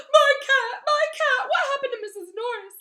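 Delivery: very high, loud anguished shriek. Acted. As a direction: shriek in horror over the cat. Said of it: "he shrieked".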